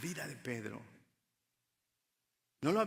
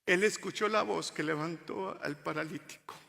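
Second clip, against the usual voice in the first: ordinary delivery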